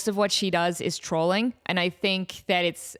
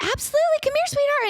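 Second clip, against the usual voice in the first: high, louder, sugary welcome; 4 dB louder, faster than usual. Retold or imitated. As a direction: welcome her in like a doting big sister. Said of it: "And she's like".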